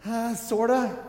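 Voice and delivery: high, hesitant half-answer; sheepish hedge. Acted. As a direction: squirm out a reluctant sorta. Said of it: "Peter is kind of there going".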